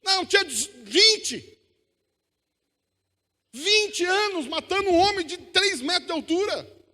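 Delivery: high, loud, slow preacher's delivery; impassioned pulpit proclamation; full-force preaching. Acted. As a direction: preach it loud, stretching the key words.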